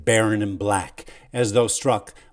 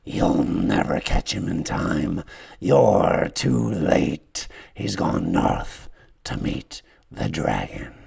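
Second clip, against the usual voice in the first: slow, breathy last whisper; dying whisper; 4 semitones lower, slower than usual. Acted. Sychus whispers his final warning to the hero. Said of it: "he whispers".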